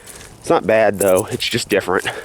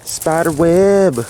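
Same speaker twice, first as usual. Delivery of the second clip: high, slow, startled exclaim; 8 semitones higher, much slower than usual. Real, not acted. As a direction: caught off guard by a web. Startled at a spider web.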